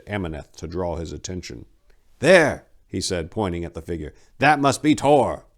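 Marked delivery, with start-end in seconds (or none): 2.2-5.5 higher, louder sharp call; sudden call. Acted. Old monk spotting the figure in the snow, urgent call. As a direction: point him out at once.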